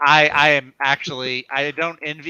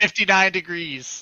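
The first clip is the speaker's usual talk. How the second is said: slow, higher drawn-out mock announcement; mock weather voice; 6 semitones higher than usual. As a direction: stretch it out like a TV weatherman.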